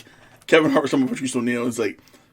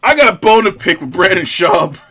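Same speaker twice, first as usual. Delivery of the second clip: louder mock complaint; mock grievance voice; 5 semitones higher, 10 dB louder than usual. Cohost mocks host's grudge against Schaub, loud mock complaint. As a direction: ham up a petty grudge.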